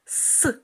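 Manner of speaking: С hissed alone before the word; isolated s. Shown as a demonstration. Teacher isolates the first sound for children. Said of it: "починається на звук с".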